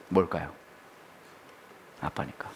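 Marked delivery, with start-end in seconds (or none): 2.0-2.5 very soft, nearly voiceless; hushed answer. Shown as a demonstration. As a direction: let the answer drop almost to a whisper. Soft refrain answering his own question. (속삭이듯 나직하게)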